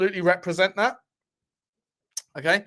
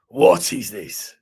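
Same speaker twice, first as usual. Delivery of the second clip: mock Nigerian accent; 5 dB louder, slower than usual. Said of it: "Nigerian accent in Sean Dyche voice".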